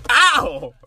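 loud, high ow; yelp from a slap. Real, not acted. Slapped back by the co-host, loud yelp. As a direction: cry out as the slap lands.

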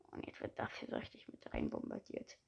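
low unvoiced whisper; hushed secretive whisper. Real, not acted. Aside while pasting.

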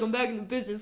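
lightly voiced, low and soft Ruben; made-up Ruben voice. Repeating how she's decided he sounds, lightly voiced low Ruben. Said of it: "just how I've decided he sounds".

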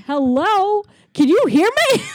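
high, loud, incredulous call; freaking out. Retold or imitated. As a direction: call out in mock exasperation, voice shooting up. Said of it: "he's freaking out".

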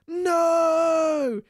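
loud high yell. Acted. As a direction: shout it at the top of your lungs. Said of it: "yelled Tom".

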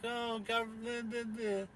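high, soft, slow dreamy voice; mocking soft dreamy voice; mocking brainwashed citizen. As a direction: parody a sheep praising the government. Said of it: "brainwashed to be like".

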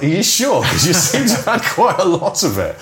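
high, loud mock concern; teasing the tipsy colleague; teasing doubt. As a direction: tease the drunk producer. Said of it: "And I was like".

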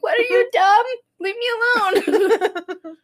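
high-pitched, whiny outburst; mock tantrum. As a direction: lash out like a tearful, irritated wife. Acted snapping at her husband.

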